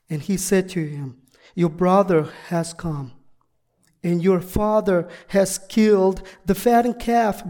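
ordinary talk, nothing marked

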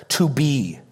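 "be" held long and set apart; emphasis on BE. Shown as a demonstration. Preacher bringing out the verb's emphasis, stress on "be". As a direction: draw out the verb "be" with deliberate weight. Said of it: "trying to draw the emphasis of the verb out".

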